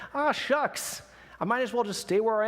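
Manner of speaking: high-pitched giving-in sigh of words; little bunny. Acted. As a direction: play a little bunny conceding defeat. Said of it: "the little bunny stops. He's like".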